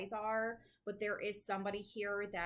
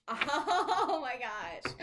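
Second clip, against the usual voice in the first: laughing gush; 7 semitones higher, 10 dB louder, much slower than usual. Real, not acted. Gushing about accents, laughing.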